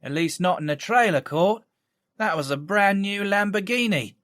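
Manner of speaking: put-on sexy lilting Cockney accent. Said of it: "A woman's Cockney voice".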